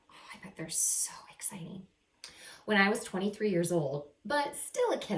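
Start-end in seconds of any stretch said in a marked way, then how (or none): 0.1-1.9 whisper. Real, not acted. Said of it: "aside to the listening kids".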